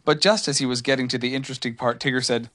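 plain reading voice

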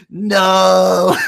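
high, loud, drawn-out no; hammed-up horror; retold horrified cry. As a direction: replay your dismay as a mock wail. Counselor horrified by girl's swearing.

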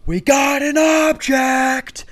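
very high, drawn-out call; woman screener. Retold or imitated. TSA screener alerting colleagues, high stretched shout. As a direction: imitate a screener calling out on one high note. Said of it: "a woman on the other side of it goes".

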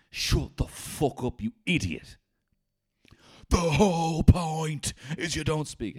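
high, slow, loud rant; hammed-up furious shouting; angry yelling. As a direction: berate the relatives who keep talking. Yelling at family during charades.

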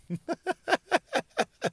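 high breathy giggle. Real, not acted. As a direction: giggle at yourself. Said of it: "I just heard that".

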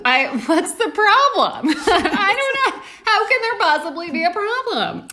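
high, loud, laughing incredulity; mock disbelief. Co-host feigns surprise at the gun plan, mock-incredulous. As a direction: cry out in exaggerated bewilderment.

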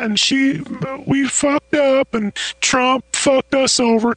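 rambling politician impression through a high voice filter; Bill Clinton. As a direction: play the ex-president making excuses. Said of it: "put you on the Bill Clinton filter".

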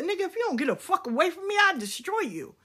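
furious threat. Retold or imitated. Telling the man who assaulted her to get away, angry and fast. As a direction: snap it at him. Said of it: "I said".

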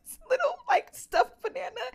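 high, breaking into laughter; can barely get it out for laughing. Real, not acted. Telling co-host about the toddler, real laughter.

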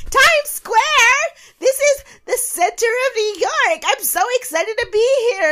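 high, loud over-the-top excitement; mock a wide-eyed tourist squealing with joy; gushing tourist squeal. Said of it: "she's like".

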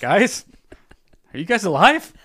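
high, loud playful call; mock calling out. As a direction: call out to a silent room. Calling out to silent cohosts.

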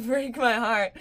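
laugh-crying prayer; teary laughing prayer. Real, not acted. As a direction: pray while close to tears. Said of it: "I'm about to cry".

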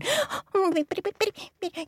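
high squeaky gasp; frightened gasp. Acted. Frogs startled by the king.

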